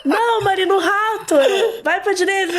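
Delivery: high-pitched mock panic, half laughing; imitating mother's shouting. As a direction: squeal frantic orders as the panicked mother. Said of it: "minha mãe começou a gritar". (imitando os gritos da mãe, rindo)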